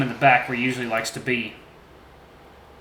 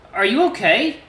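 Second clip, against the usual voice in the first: gentle T J voice; 10 semitones higher, 7 dB louder than usual. A narrator's reading. T J asks about the oatmeal in his high voice. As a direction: voice the friendly lynx lightly. T J concerned.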